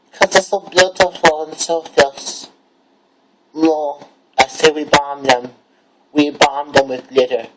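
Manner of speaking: high, sassy drag queen. Acted. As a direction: go high and fierce, full sass.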